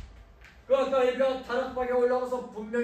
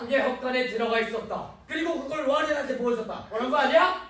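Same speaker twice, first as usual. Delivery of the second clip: loud, rising interrogation; building, forceful cross-examination; angry accusation; 3 semitones higher, 6 dB louder than usual. Acted. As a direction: press the accusation harder with each phrase. Father angrily cross-examines her.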